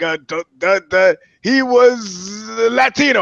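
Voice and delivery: high, slow stammering guess; mock dumb witness. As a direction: play it dim and dragged out. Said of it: "I'm sitting there like".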